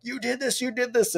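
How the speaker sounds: high-pitched mock cheering from teammates. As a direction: raise the pitch way up like teammates congratulating. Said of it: "being like".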